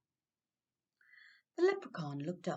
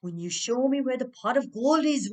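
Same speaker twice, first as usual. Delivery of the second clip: much louder, menacing roar; 4 semitones higher, 11 dB louder than usual. Acted. Dan roaring his demand at the trapped leprechaun, much louder. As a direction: roar the terms at him. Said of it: "roared Dan".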